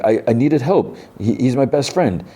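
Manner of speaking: high-pitched retold plea; acted pleading. Boy pleads with armed leader, high acted distress. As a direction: plead high and upset, as an imitation.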